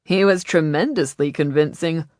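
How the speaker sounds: loud, teasing admiration; emphatic, playful approval; admiring. A narrator's reading. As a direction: praise him with a wink in it. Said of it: "Freeze said admiringly".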